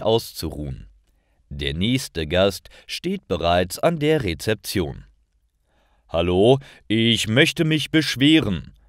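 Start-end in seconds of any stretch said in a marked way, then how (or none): 6.1-8.7 louder, higher, put-upon guest voicing his complaint; complaining hotel guest. Guest complains to receptionist Lisa, louder and higher. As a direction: play the annoyed guest at the front desk. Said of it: "sagt er".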